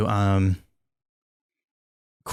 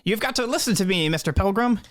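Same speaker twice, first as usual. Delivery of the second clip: high, fast pleading voice; pushy plea pitched up high; NPC plea; 9 semitones higher than usual. Acted. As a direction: beg him urgently in a raised voice.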